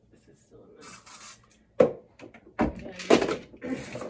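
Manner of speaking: usual speaking voice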